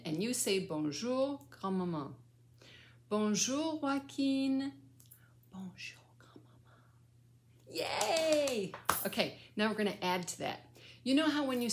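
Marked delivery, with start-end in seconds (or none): 3.1-4.7 high, held-out last note; sing-song greeting. Shown as a demonstration. Grandmother greeting her grandchild in French, sing-song. 5.5-6.8 barely audible whisper; whispered prompt. Shown as a demonstration. Grandmother prompting the child's reply, whispered. 7.7-8.7 high, drawn-out cheer. Real, not acted. Grandmother praising the child's French greeting, cheering.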